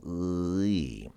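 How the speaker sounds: ы stretched, gliding from "uh" into "ee"; drawn-out teaching demo of ы; transition within ы. Shown as a demonstration. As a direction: slide slowly through both vowels of ы. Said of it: "and then go from".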